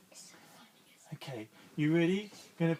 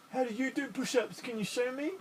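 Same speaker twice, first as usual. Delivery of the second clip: starfish asking; 8 semitones higher, 3 dB louder than usual. Acted. Starfish asks the fish.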